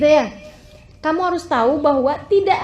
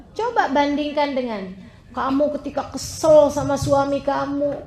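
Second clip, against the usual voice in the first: usual speaking voice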